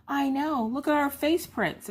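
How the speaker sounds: high, slow child impression; gleeful kid voice. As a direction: show off your mess like a delighted kid. Voicing children's gleeful reply.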